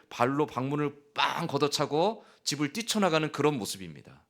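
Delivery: normal talking voice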